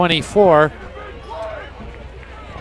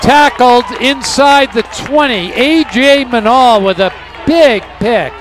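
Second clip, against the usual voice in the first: excited call; 7 semitones higher, 4 dB louder than usual. Real, not acted. Live play-by-play call.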